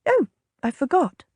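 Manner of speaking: put-on high, teasing young lady; young lady's high voice. A narrator's reading. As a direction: tease him as a bright young lady.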